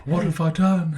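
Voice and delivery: put-on clone trooper in a Kiwi accent; dismayed line in a New Zealand accent. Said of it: "in a Kiwi accent, obviously".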